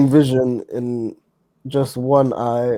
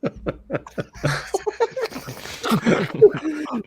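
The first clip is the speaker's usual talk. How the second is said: long high-pitched burst of laughter; 4 dB quieter than usual. Real, not acted. Laughing at misheard word.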